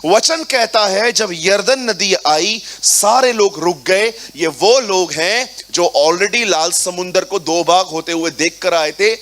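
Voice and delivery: forceful preaching. Acted. Pastor preaching the Jordan crossing to the congregation, forcefully. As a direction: drive the scripture home to the church.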